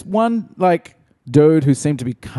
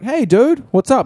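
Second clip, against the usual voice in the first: mock casual greeting; 11 semitones higher, 4 dB louder than usual. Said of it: "And I'd be like".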